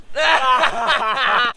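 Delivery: short mocking laugh; scornful laugh. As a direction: laugh at the absurd idea. Mocking slaves having rights.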